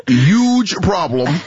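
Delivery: very slow, stretched "huge problem"; hammed-up politician impression; Bernie Sanders. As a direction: drag out "huge" like a stumping senator. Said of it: "uh, Bernie Sanders".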